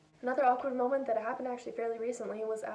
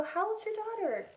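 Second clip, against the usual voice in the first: much higher, bright eager ask; eager question; 11 semitones higher, 3 dB louder than usual. Retold or imitated. A cosplayer asking a dad about his child, much higher and eager. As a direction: ask with hopeful excitement.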